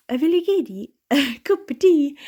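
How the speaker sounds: quick sing-song island accent demo; Lewis accent. Shown as a demonstration. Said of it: "if they're all from, uh, Stornoway or Lewis and Harris".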